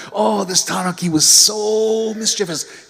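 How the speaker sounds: high, slow, playful delight; playful storyteller. A narrator's reading. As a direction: relish the mischief. Storyteller savours mischief.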